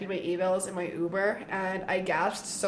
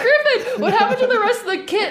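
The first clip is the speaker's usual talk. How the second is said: much higher and louder, laughing disbelief; exasperated; 13 semitones higher, 9 dB louder than usual. Retold or imitated. To her brother Griffin, laughing exasperated shout. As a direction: call out in mock outrage. Confronting brother about drum kit.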